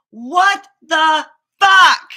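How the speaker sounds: slow, loud, stretched cry of disbelief; drawn-out exasperated yell. Real, not acted. Venting to camera about her stomach, a slow loud yell. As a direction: drag it out loud and anguished. Repeated outburst, louder, drawn out.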